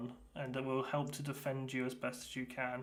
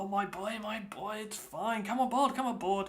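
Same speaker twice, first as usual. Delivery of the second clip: raised, hearty reassurance; a captain welcoming the boy aboard; jolly captain; 8 semitones higher, 5 dB louder than usual. Acted. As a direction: wave the boy aboard warmly.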